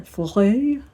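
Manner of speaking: put-on "Flore" drawn up in a French accent. Vlogger retrying a café name, French accent.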